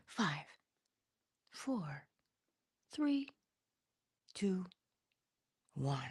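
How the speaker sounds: countdown dropping to a whisper; fading countdown. Real, not acted.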